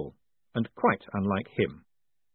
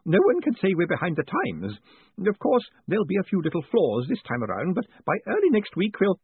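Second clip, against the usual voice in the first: high, bubbly, eager craftsman; a kindly engraver tripping over his thanks; pleased old tradesman gushing over a visitor; eager Mister Spools; 8 semitones higher than usual. A narrator's reading. Spools boasts of his press.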